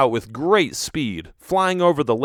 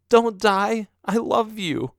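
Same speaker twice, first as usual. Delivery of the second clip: tearful plea; 9 semitones higher, slower than usual. Acted. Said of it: "I cried softly".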